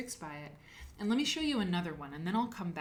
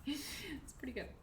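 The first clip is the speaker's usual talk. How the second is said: unvoiced whisper, higher and softer; whispered aside; 7 semitones higher, 7 dB quieter than usual. Real, not acted. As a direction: slip in a private aside in a whisper. Aside about the chips.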